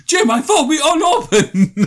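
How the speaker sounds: very high, loud laughing squeal; excited kid voice. Retold or imitated. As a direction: blurt it like a giddy kid. Voicing thrilled Luke.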